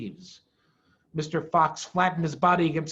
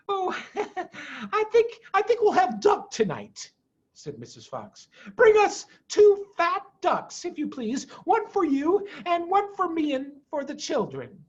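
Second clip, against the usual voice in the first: much higher, laughing, in a lighter voice; Mrs Fox; 11 semitones higher than usual. Acted. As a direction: giggle sweetly as the vixen. Mrs Fox answers her husband.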